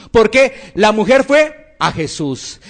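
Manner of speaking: ordinary talk, nothing marked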